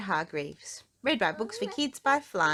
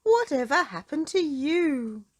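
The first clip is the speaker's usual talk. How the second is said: higher, louder fussing concern; concerned doctor; 7 semitones higher, 6 dB louder than usual. Acted. Doctor Make-You-Well to bruised Mister Small, higher fussing concern. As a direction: fuss over the patient.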